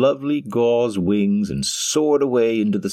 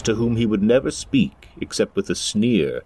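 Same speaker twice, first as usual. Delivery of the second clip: plain reading voice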